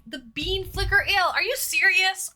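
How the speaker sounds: playing up the shock at a cheeky pun; amused disbelief. Real, not acted. Reacting to chat pun.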